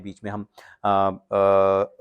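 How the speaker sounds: ordinary delivery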